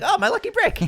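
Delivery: very high squeaky cartoon voice. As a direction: squeal it like a goofy cartoon character. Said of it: "He's like".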